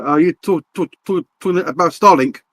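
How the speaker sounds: normal talking voice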